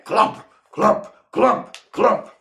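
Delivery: stomping footsteps. A narrator's reading. Heavy footsteps imitated.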